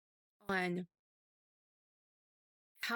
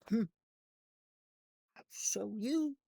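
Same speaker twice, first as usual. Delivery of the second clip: pouty. Retold or imitated. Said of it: "I was all pouty".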